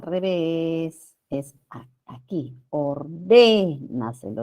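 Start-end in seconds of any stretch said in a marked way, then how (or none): 2.7-4.4 Ordénaselo with DÉ drawn out slowly; Ordénaselo said slowly as or-DÉÉ-na-se-lo; sobresdrújula stress. Shown as a demonstration. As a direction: stretch and lean on the DÉ in Ordénaselo. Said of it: "Es una palabra sobre esdrújula".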